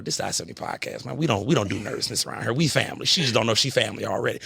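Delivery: ordinary talk, nothing marked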